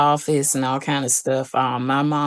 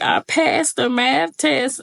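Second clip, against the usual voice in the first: higher, slow happy announcement; tearful joy; 11 semitones higher, slower than usual. Retold or imitated. Daughter telling mom she passed, high and happy. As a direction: blurt the good news through tears.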